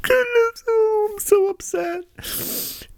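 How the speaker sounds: high, whiny falsetto of mock distress; mock weepy liberal. Host mocking the spokeswoman's tears, whiny falsetto. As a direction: ham up fake outrage in a squeaky voice.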